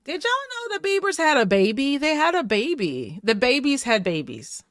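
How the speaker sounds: ordinary delivery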